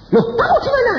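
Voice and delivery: very high, loud shriek of surprise, acted; startled wife. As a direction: cry out as the frantic wife on the phone. Retold wife startled on the phone. (jeritan kaget istri di telepon)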